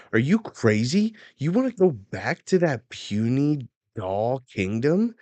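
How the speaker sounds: mock yelling. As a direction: yell back in indignant disbelief, hammed up. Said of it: "yelling back".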